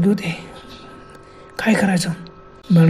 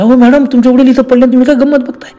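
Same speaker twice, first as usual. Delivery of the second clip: higher-pitched, alarmed calling to madam; urgent reproach; 6 semitones higher, 4 dB louder than usual. A narrator's reading. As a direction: act a neighbour urgently taking someone to task. Neighbours reproach madam over fallen father. (घाबरून जाब विचारणारा, चढलेला सूर)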